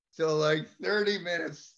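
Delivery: normal talking voice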